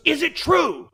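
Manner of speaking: high, loud demanding shout; principal's yell. Retold or imitated. Principal storming out at the student, high shouted demand. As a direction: bellow it like an outraged principal. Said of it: "He goes".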